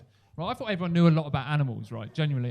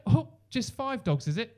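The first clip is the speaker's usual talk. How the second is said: smug sarcasm; 7 semitones higher, slower than usual. Retold or imitated. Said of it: "He went".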